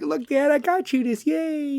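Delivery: put-on eager kid. A child giving dad a present, high and eager. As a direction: pipe up like an excited kid. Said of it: "if they're like".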